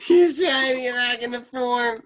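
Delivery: very high, loud squeal through laughter; real helpless laughter. Real, not acted. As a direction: squeal it out while cracking up. Cracking up watching the impression.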